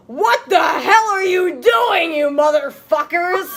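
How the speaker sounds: loud, hammed-up angry shout; furious yell. Dumbledore catching the couple, hammed-up furious shout. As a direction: burst in roaring at them.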